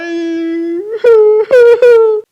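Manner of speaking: high, loud cackling laugh; a loud, high crying laugh; loud wild laugh. Real, not acted. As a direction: burst into a shrieking laugh. Laugh after joke.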